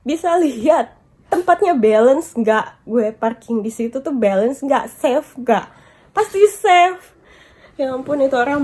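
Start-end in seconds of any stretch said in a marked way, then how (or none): none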